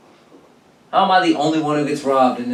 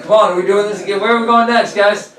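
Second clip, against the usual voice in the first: higher, louder gleeful buddy voice; eager tag-along; 8 semitones higher, 5 dB louder than usual. Retold or imitated. Cashier cheering on the robbers as a new gang member. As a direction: sound thrilled to join the gang.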